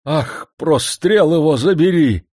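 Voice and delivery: high, slow angry oath; angry curse. A narrator's reading. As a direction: curse it loudly. Old man curses.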